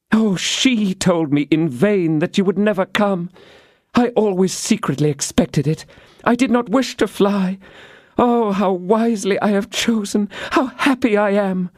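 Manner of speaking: put-on high young woman's delighted voice; young woman glowing with happiness; Constance, happy. A narrator's reading. Constance tells D'Artagnan her joy, high and delighted.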